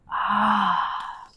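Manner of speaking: long, soft sighed "ah"; audible exhale. Shown as a demonstration. Guide releases pinched shoulder blades, soft sigh. Breathing out after stretch.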